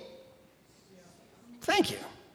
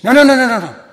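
put-on loud, higher eager kid shouting no; a know-it-all boy cutting in loudly; excited shout; 10 semitones higher, 17 dB louder, much faster than usual. Boy James corrects a classmate.

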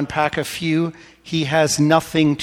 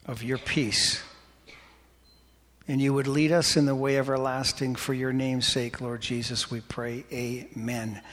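prayer; 2 semitones lower, 6 dB quieter than usual. Real, not acted. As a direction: pray softly and unhurried, settling to a quiet amen. Leads the room in prayer.

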